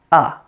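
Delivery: short relaxed "uh"; weak schwa. Shown as a demonstration. Teacher showing the middle syllable of theater, relaxed schwa. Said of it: "a weak, quiet schwa sound".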